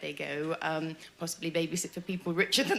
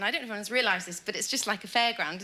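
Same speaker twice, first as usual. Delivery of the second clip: normal talking voice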